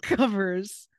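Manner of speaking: usual speaking voice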